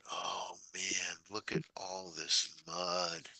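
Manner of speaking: slow soft whisper. Retold or imitated. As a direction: whisper it to yourself, slowly. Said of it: "I'm like".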